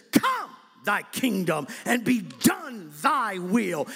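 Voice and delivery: "Come thy kingdom" slow, with "Come" punched; slow emphatic reading. Shown as a demonstration. Preacher quoting the Greek to his congregation, slow and emphatic. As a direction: read it slow, leaning hard on "Come". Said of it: "it literally says this".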